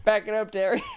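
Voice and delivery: high, loud playful order; mock command. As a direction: boss Terry around jokingly. Joking command to Terry.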